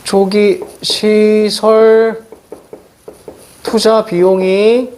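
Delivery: very slow, word by word, held level; drawn-out dictation. Real, not acted. Teacher noting the example for students, very drawn out. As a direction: stretch each word with pauses between. Teacher slowly repeats phrase, likely writing.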